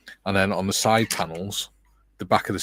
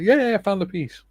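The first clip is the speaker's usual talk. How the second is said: excited; 12 semitones higher, 6 dB louder than usual. Pretend.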